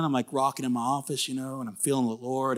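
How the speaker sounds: ordinary talk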